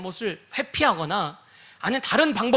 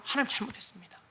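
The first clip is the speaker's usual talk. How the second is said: humble confession; 9 dB quieter, faster than usual. Retold or imitated. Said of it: "내가 잘못한 것은 어떻게 한다고요?".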